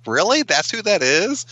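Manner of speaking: high-pitched, incredulous double take; surprised disbelief. Acted. Viewer finally looking up a celebrity cameo, high incredulous surprise. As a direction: ask it high, as if you can't believe it. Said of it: "and you're like".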